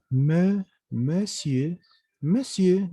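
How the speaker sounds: monsieur built up from "me" to "me-sie"; vowel as E. Shown as a demonstration. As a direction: isolate "me", then say monsieur whole. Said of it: "se vuelve una E". (monsieur con E: "me", "me-sie")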